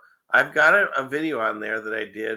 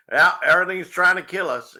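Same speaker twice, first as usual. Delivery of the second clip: mock Australian accent, louder and higher; 7 semitones higher, 6 dB louder than usual. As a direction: put on an amateur Aussie accent. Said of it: "that's my Australian accent".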